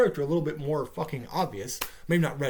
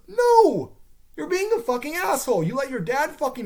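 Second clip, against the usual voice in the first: high, loud furious no; angry yell; 10 semitones higher, 4 dB louder than usual. Real, not acted. Ranting at the character, furious yell. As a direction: shout her down.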